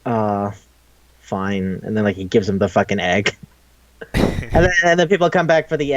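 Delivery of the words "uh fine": low, reluctant retold concession; backing down. Leon gives in to Count D, low and grudging. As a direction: give in, voice dropping. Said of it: "Leon just backs down".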